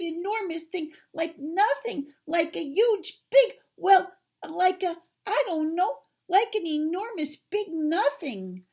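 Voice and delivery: high, loud, stammering description; frantic high character voice, building to a loud finish; panicked Piglet. Acted. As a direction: grope for words in frightened excitement.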